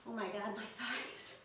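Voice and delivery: breathless, winded groan; out of breath. Real, not acted. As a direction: gasp it out, winded. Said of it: "I cannot talk".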